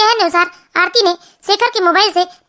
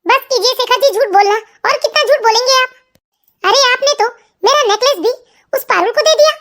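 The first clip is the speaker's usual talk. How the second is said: higher-pitched, aggrieved wife demanding answers; betrayed wife; 4 semitones higher than usual. Acted. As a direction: plead and accuse in a raised voice. Narrator plays betrayed, angry wife. (आहत पत्नी, शिकायत भरे ऊँचे सुर में)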